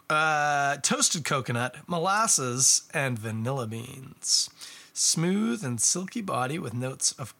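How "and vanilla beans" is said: ordinary talk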